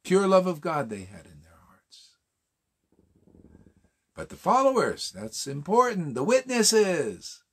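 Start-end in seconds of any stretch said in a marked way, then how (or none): 0.9-2.2 hushed reverent whisper; soft whispered awe. Real, not acted. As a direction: breathe it out with awe. Reverent aside on devotees' love.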